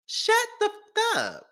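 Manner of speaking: high, slow, drawn-out shut the fuck up; mock hush again. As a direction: stretch every word out in a high voice.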